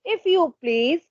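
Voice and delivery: put-on high childlike voice; odd little child voice. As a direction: ask as a tiny boy would. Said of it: "This voice said".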